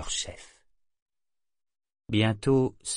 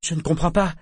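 high, louder insistence; insistent; 8 semitones higher, 5 dB louder, faster than usual. A narrator's reading. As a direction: repeat it, pleading to understand. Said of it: "répéta Shriver".

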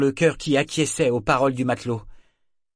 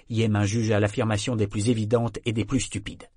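usual speaking voice

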